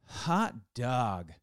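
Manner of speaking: "Hot dog" as two spaced, drawn-out beats; joyful exclamation. Shown as a demonstration. Something great happens and you go, joyful exclamation. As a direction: celebrate great news, stretching both words. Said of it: "something great happens and you go".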